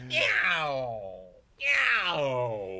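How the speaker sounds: big bad kitty meow. Shown as a demonstration. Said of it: "Followed by Big Bad Kitty".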